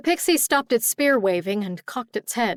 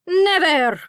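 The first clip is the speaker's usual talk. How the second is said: high, loud pixie war cry; defiant cry; 9 semitones higher, 6 dB louder than usual. A narrator's reading. Disarmed pixie refusing to surrender, defiant shout.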